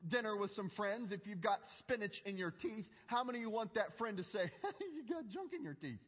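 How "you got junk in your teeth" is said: laughing. Retold or imitated. Blunt friend quoted, laughing.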